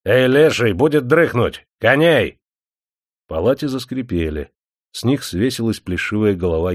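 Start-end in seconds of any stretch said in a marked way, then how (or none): 0.0-2.4 loud, rough wake-up call; shouting at the host. A narrator's reading. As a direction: yell him off the bunk. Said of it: "гость крикнул хозяину".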